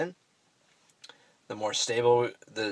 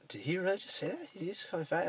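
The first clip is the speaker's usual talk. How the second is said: disbelief; 10 semitones higher, 6 dB quieter than usual. Retold or imitated. Inner reaction to the insult.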